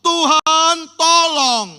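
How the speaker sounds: high, slow, drawn-out plea to God; desperate cry, high and slow; crying out for help. Acted. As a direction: wail it out as a desperate people begging. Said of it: "lalu mereka berteriak-teriak".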